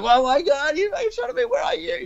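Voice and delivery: very high-pitched imitated panic; mock freak-out. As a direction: squeal it like a friend losing it. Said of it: "he just starts freaking out".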